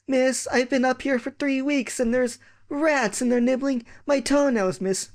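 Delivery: high whining complaint; whiny prisoner kid. Retold or imitated. Locked-up pupil begs teacher, high whine. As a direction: whimper it like a locked-up child.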